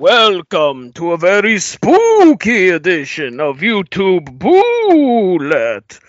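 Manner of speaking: slow, drawn-out spooky announcer; creepy horror host voice. Pretend. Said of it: "I'm not doing that voice the entire episode".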